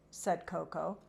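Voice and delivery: ordinary delivery, nothing marked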